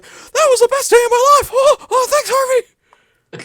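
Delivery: very high, loud overjoyed squeak; Mickey Mouse-esque voice. Retold or imitated. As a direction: gush with squeaky cartoon delight. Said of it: "that weird Mickey Mouse-esque voice".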